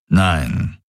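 grumbled, low refusal; deep, grunted word; low grumble. A narrator's reading. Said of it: "brummte Daraios".

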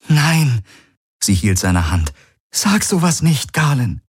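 hohe Frauenstimme, eindringlich bittend; Ki, flehend. Acted. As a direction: bitte ihn inständig, hoch gesprochen. Ki pleads. (Ki bittet Galen: hohe, flehende Stimme)

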